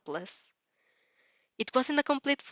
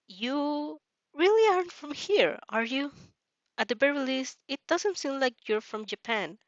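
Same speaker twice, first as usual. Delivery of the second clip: put-on higher, gentle boyish voice, a bit hesitant; lightly voiced worried boy; Izuku's voice; 5 semitones higher than usual. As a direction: lift the pitch for a nervous shy boy. Reader voices Izuku again.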